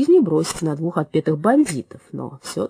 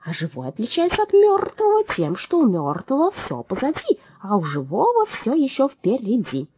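a lively parrot voice, raised; chirpy higher bird voice; 7 semitones higher than usual. A narrator's reading. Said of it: "догадался Джерри".

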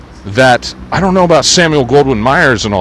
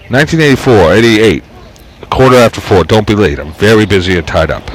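put-on louder old New York character voice; 4 dB louder than usual. Reading the book's caller aloud as an old New Yorker.

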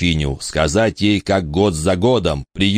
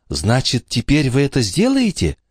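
lightly voiced anxious boy, higher; Michael asking nervously, a hint of a boy voice; 7 semitones higher than usual. Michael nervously asking the countess, lightly voiced.

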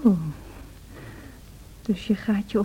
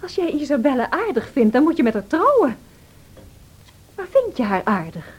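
ordinary talk, nothing marked

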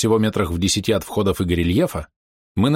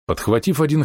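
plain reading voice

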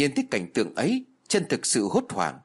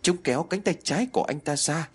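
usual speaking voice

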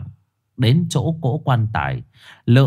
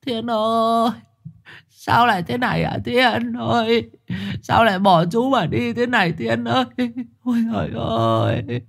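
sobbing wail; 12 semitones higher than usual. A narrator's reading. Uncle hugs the lifeless boy, wailing and sobbing. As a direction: wail the name in grief. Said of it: "mà khóc nức nở".

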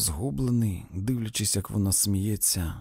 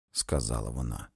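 ordinary delivery, nothing marked

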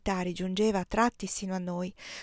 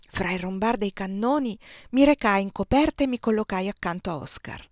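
usual speaking voice

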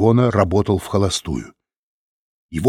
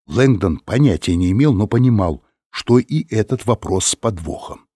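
usual speaking voice

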